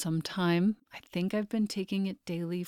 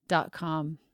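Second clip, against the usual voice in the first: normal talking voice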